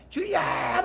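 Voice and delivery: high, drawn-out mock holler; mock crying out. Preacher mimics people shouting to the Lord, high mock cry. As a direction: imitate a strained call without full volume. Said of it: "막 소리를, 소리를 지르겠어요?".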